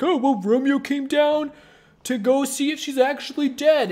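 squire's voice. Retold or imitated. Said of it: "he's like".

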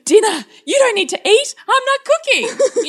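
very high mock-tantrum protest; hammed-up refusal. Mother refusing to make dinner for her kids, hammed-up tantrum. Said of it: "I ham it up".

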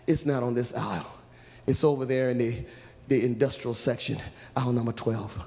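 low, restrained passer-by; low-voiced helpful stranger; ordinary man. Angel calmly gives directions.

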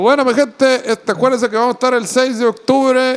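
anuncio del show. Acted. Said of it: "anuncio al público".

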